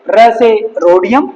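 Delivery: ordinary talk, nothing marked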